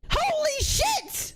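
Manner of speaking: high, drawn-out exasperated cry; worked-up exasperation. Real, not acted. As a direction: stretch it out in high disbelief. Exasperated rant over accents.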